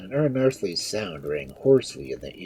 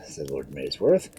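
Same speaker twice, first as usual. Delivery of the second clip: ordinary delivery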